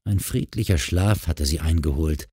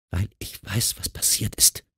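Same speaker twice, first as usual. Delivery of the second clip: soft, half-whispered admission; hushed confession; 2 semitones higher, 7 dB quieter than usual. A narrator's reading. Wife admits what she knows to her husband, hushed. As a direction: drop to a near whisper as you admit it. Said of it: "sagte Nicole".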